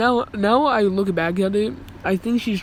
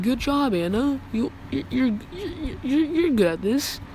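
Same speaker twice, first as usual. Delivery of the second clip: imitated principal praise; 5 semitones higher than usual. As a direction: praise haltingly in a lightly put-on high voice. Principal voiced praising the favoured girl.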